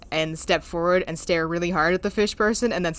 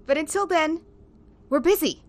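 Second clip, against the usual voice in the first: higher voice; 9 semitones higher than usual. Acted. N brushing off Willow in character.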